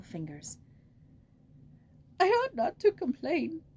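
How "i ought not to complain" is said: put-on higher, trembling old voice broken by sobs; trembling, sobbing. A narrator's reading. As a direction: speak through the last of her sobs. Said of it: "said the trembling voice, still broken by sobs".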